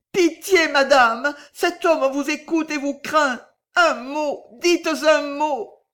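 high, pleading cry; supplication désespérée. A narrator's reading. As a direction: beg desperately for pity. Fille supplie la bohémienne. (supplication aiguë, affolée)

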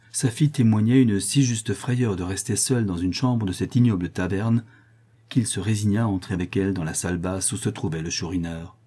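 plain reading voice

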